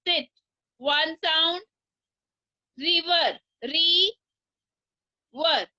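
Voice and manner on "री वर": री and वर said apart with a pause; syllables split. Shown as a demonstration. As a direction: break river into री ... वर. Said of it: "टू sound". (River को री ... वर तोड़कर बोलना)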